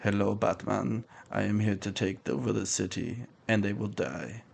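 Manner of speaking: put-on German accent, flat menace, barely above a murmur; Werner Herzog deadpan. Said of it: "he fucking sounds like Werner Herzog".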